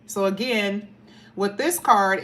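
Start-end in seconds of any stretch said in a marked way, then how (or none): none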